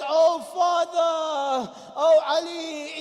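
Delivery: slow, high, anguished call; high drawn-out lament; grieving cry. Acted. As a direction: cry out to a dying father. Hassan calls out to father.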